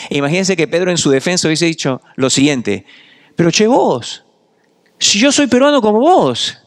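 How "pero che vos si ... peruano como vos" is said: put-on high Argentine "che vos" protest, Argentine accent. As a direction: Peter denying in a thick Argentine accent. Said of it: "Con un acento más argentino". (con acento argentino marcado, agudo)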